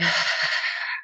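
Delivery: big letting-go exhale; audible sigh. Shown as a demonstration. Host shows guests how to breathe out, audible sigh. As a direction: breathe all the way out in an unvoiced rush of air. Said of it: "breathing out with a sigh".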